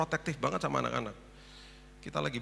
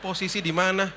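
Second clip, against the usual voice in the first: loud, raised-pitch blunt demand; 6 semitones higher, 8 dB louder than usual. Retold or imitated. Housekeeper phoning employer late at night, bluntly demanding. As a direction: imitate a brusque interrogation by phone. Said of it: "Nggak ada basa-basinya gitu".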